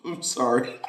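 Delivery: breaks into high laughter; laughing. Real, not acted. Vlogger cracking up at his own bit, laughing. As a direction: crack up at your own joke.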